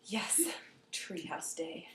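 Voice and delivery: whispered, secret excitement; hushed glee. Retold or imitated. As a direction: whisper it to yourself, thrilled. Child's private glee.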